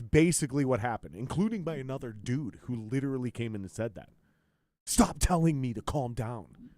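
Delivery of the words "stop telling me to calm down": slow, louder, higher indignant whine; mocking angry reply. Mocking another guy who snapped at him online, indignant whine. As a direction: parody someone offended.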